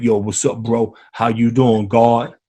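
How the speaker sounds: a low, easy [name] "what's up, God"; [name] impression. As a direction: play it cool and low like [name]. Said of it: "And you know [name]".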